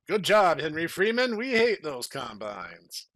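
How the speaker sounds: loud, cheering praise from the crowd. Acted. Crowd cheering Henry Freeman, loud praise. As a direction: shout it out like a grateful crowd.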